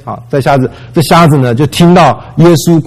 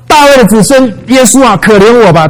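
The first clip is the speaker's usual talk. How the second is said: high, loud desperate cry for mercy; blind man crying out; 7 semitones higher, 3 dB louder than usual. Retold or imitated. Blind beggar shouting to Jesus over the crowd, high loud plea. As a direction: call out high and loud, begging. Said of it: "他就是在喊啊".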